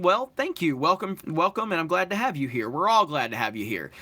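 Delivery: normal talking voice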